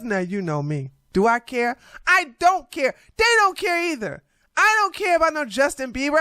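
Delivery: ordinary talk, nothing marked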